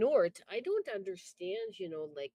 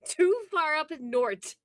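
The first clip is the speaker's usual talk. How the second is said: Wisconsin accent, slow and loud, leaning on "Too"; hammed-up Wisconsin "Too far up in north", slow and loud; mock Wisconsinite saying "Too far up in north"; broad Wisconsin accent; 4 semitones higher, 10 dB louder than usual. Shown as a demonstration. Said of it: "It's our accent".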